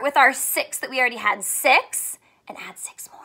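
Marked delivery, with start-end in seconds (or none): 2.5-3.2 a quick hushed whisper. Acted. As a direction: drop to a whisper for the aside. Whispered aside while counting.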